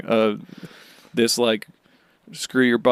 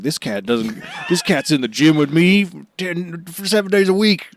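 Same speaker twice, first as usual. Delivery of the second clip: proud shout; 5 semitones higher than usual. Retold or imitated. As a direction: boast like a gym bro about his cat. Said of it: "being like".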